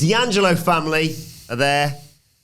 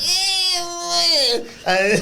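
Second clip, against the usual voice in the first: mock Italian grunt. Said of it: "Ray's all like".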